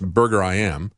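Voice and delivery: "Burger I M" said as burger, eye, am. Shown as a demonstration. Host passing on the chain's own pronunciation, "Burger I M" as burger-eye-am. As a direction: say "Burger I M" with the last letters as "I am". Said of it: "say that it's pronounced".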